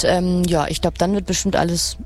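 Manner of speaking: normal talking voice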